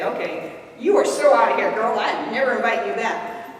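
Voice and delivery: sassy dismissal with a loud out of here; mock brush-off. Imagined reply to a blunt friend, playfully huffy. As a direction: toss the friend out, half joking. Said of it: "and you say".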